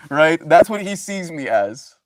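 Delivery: ordinary talk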